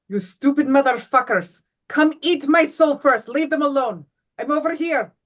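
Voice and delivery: loud, high taunting shout; cursing yell. Acted. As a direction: bellow insults to draw them off. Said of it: "Just start cursing up a storm".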